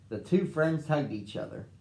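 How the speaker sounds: plain reading voice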